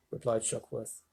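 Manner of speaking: usual speaking voice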